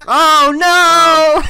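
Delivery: loud laughing "oh no"; hammed-up shock through a laugh; mock dismay. Mock dismay at dropped show.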